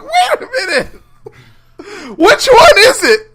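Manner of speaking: high, laughing, bewildered outburst; baffled laughing shout. Retold or imitated. Podcaster mocking Thor's confusion, laughing high shout. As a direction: crack up while asking which one it is.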